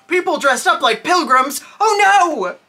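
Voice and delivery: high, loud, hammed-up fright; sarcastic pretend panic; mock terror. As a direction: exclaim in exaggerated fake horror. Mocking kids' silly fears.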